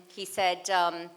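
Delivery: usual speaking voice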